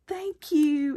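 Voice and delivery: ordinary talk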